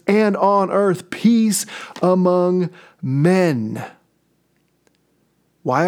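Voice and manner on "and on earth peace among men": proclaiming. Retold or imitated. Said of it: "shouting out".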